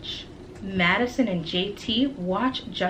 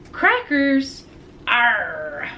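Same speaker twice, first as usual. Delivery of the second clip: pirate squawk; 3 semitones higher, 3 dB louder, much slower than usual. Acted. Pirate parrot in drawing.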